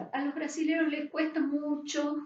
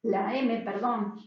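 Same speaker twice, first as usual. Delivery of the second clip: normal talking voice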